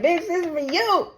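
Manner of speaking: high-pitched exasperated insistence; getting mad. Real, not acted. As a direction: insist in mild anger, pitch climbing high. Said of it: "I'm even getting mad like".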